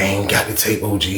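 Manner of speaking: low, soft denial; subdued reply. Retold or imitated. Answering OG about the tape, subdued and low. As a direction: drop the voice and answer quietly.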